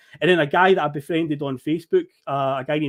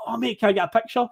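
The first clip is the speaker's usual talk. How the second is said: quick, higher-pitched imitation of a fan; eager fan request; 6 semitones higher, faster than usual. Fan at the gates asks for a photo, eager. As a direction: rush the request out like a keen fan.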